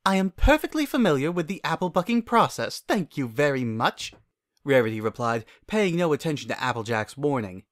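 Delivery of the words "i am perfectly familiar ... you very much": Rarity's voice. Acted. Rarity voiced, haughty reply.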